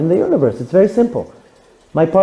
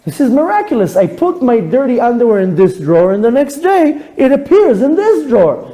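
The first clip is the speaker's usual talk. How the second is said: put-on child's wonder; 7 semitones higher than usual. Child amazed at laundry.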